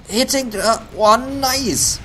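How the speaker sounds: high, loud excited shout; excited cheer. Real, not acted. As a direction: shout it out in delight. Caster cheering a kill.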